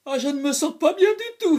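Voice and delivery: scared. A narrator's reading. Boy panicking in the dentist chair, frightened. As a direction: panic rising as the drill comes.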